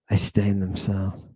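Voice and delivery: quiet repeat. Real, not acted.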